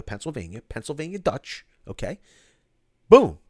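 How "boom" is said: loud, high mic-drop boom; emphatic boom. Real, not acted. Host settling the pretzel argument with the chat, loud and triumphant. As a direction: slam it down as the final word. Punchline after pretzel lecture.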